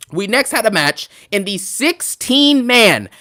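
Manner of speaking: booming mock big-match intro; hyped announcement. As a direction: hype it up like a drum roll moment.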